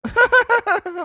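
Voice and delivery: high loud scoffing laugh; mocking laugh. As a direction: burst out in a mocking cackle. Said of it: "her response was this".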